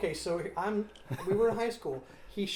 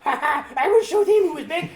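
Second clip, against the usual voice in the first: high loud laughing French villain; bad French accent; 11 semitones higher, 13 dB louder than usual. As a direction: gloat in an over-the-top French accent. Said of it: "spoke with a bad French accent".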